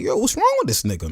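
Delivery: high-pitched mock exasperation; KD in disbelief. KD griping about Draymond to teammates, exasperated.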